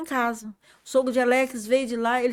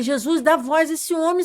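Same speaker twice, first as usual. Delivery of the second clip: higher, louder plea to Jesus; 6 semitones higher, 5 dB louder than usual. Retold or imitated. Asking Jesus to give the silent man his voice, loud plea. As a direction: beg for his voice to come back. Begging God for the man's voice.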